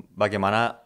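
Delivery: normal talking voice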